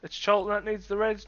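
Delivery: usual speaking voice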